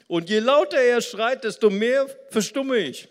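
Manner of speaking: high-pitched, very loud mock complaint; acted quarrel shout. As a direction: shout it like a hammed-up argument. Acted quarrel continues after laugh. (hoch und laut geschrien, gespielt)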